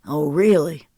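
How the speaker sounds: a touch of a cold taunt; doubting, dry challenge; mild sarcasm. Acted. Jealous man challenging the woman, mildly sarcastic.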